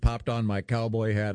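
ordinary talk

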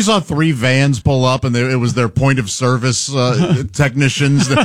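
ordinary talk, nothing marked

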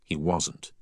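ordinary delivery

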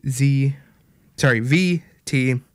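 usual speaking voice

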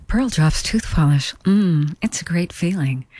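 lightly put-on ad voice with a savored "Mm"; mimicked TV commercial. Comedian recalling ads she copied as a child, mimicked commercial voice. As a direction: sell it like an old commercial pitch. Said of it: "I started mimicking commercials".

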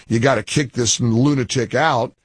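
ordinary talk

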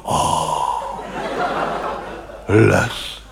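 hushed low mock wonder at a fake forest; mock awed gasp. Comedian mimics a theater crowd's hushed amazed gasp. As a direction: imitate a whole audience gasping in awe. Audience gasps at scenery.

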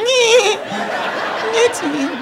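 high, soft little giggly voice; tiny doughboy voice. Acted. Doughboy talking without fingers, high soft squeak. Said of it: "It's more like".

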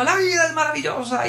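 high, ecstatic cry from on top of a desk; shouted from the desk. Retold or imitated. Teacher on the desk crying out to students, high and ecstatic. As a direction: proclaim it standing on a desk. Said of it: "se subía en el pupitre y gritaba".

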